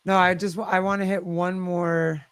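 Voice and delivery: normal talking voice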